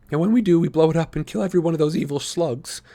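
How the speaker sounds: lightly voiced boy. A narrator's reading. Tobias voiced in story.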